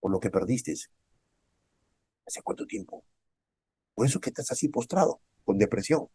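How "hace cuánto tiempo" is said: whispered question. Real, not acted. Preacher asking the grieving.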